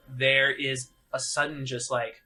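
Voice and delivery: usual speaking voice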